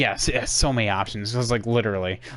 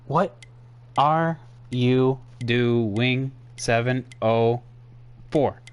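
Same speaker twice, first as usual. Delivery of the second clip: very slow, each word set apart; slow exasperation; much slower than usual. Real, not acted. Streamer asking seven oh four about repeated tweets, slowly exasperated. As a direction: pause between words in drawn-out disbelief.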